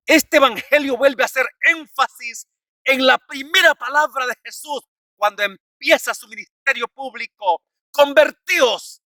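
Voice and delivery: high, emphatic pulpit proclamation; forceful preaching. Real, not acted. (predicación enérgica, en tono alto)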